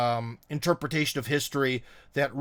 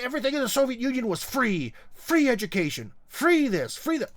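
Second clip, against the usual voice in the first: mock gushing; 9 semitones higher than usual. Parodying Soviet nostalgia claims.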